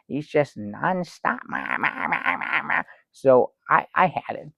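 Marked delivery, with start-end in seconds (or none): 1.5-2.8 mock baby babble. Imitating the baby.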